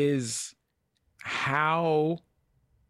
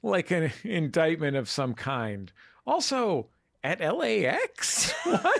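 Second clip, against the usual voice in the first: high, baffled outburst; mock incredulity; 5 semitones higher, slower than usual. Host questions glamour at the airport, mock incredulity. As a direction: climb into high-pitched disbelief. Acted baffled reply to the remark.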